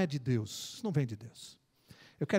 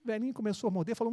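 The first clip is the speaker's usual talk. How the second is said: ordinary talk, nothing marked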